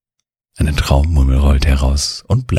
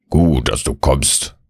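grumbling woodland gnome. A narrator's reading. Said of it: "brummt er zu Mieze".

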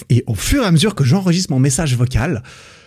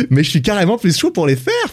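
laughing through the words; 7 semitones higher than usual. Real, not acted. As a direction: say it while laughing. Laughs mid-sentence.

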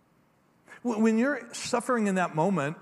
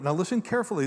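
ordinary talk

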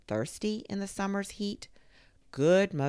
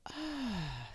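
soft falling sighed "Ah"; 7 semitones higher, 7 dB quieter than usual. A narrator's reading. The maple tree sighs softly after the schoolgirls leave. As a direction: breathe it out wistfully. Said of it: "sighed the maple tree".

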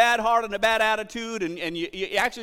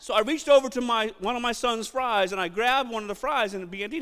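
usual speaking voice

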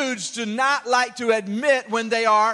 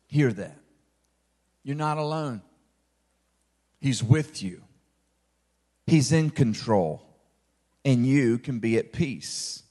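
gentle reassurance; 6 semitones lower than usual. Acted. Pastor reassuring his listeners they are not alone, low and tender. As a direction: drop much lower and hushed, tender.

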